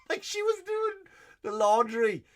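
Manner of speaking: high, squeaky laughing voice; incredulous laughing. Real, not acted. Son recalling mother's laundry greeting, incredulous high laughing. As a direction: squeal it through helpless laughter.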